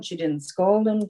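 normal talking voice

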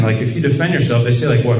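ordinary delivery

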